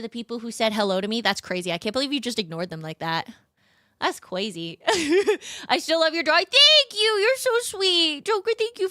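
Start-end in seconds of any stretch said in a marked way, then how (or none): 6.4-8.9 high cutesy thanks. Pretend. Streamer thanks chat for praising her drawing, in a high cutesy voice. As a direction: coo your thanks.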